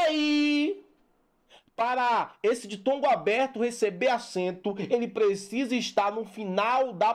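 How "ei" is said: "ei" held high with an open e; open diphthong. Shown as a demonstration. Teacher showing the open diphthong, "ei" long and high. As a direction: stretch "ei" high, opening the e wide. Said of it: "ei não".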